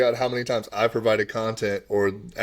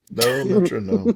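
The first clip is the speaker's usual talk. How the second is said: playful echo; 7 semitones higher, slower than usual. Pretend. Golfer echoing his own metronome line, playful singsong. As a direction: stretch it out teasingly, like a hook.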